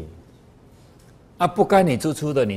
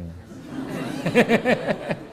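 amused laugh; 8 semitones higher than usual. Real, not acted. To the audience, laughing at both sides profiting, amused laugh. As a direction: open laugh after the punchline.

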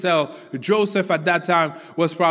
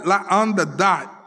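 normal talking voice

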